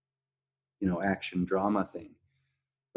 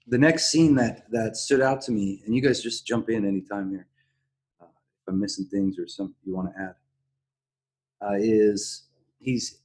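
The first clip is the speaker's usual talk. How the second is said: ordinary talk, nothing marked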